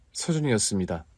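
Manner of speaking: usual speaking voice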